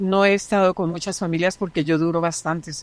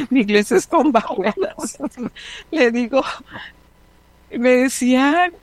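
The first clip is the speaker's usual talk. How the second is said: laughing; 6 semitones higher than usual. Real, not acted. Laughing at her own English.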